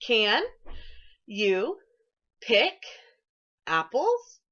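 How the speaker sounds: "Can" "you" "pick" "apples" each set apart with pauses; word by word. Shown as a demonstration. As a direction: count each word out separately. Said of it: "Let's count the words in that sentence".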